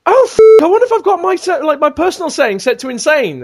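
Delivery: high, mock-dawning horror; sudden realisation. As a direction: imitate a friend realising his mistake. Acting teammate's sudden realisation.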